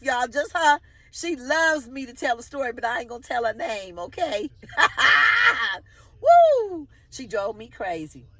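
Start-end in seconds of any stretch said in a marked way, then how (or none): 4.5-5.8 high shrieking burst of laughter; loud whooping laugh. Real, not acted. Laughing at her own jealousy. 6.2-6.9 very high, loud woo; excited whoop. Real, not acted. Excited after the laugh.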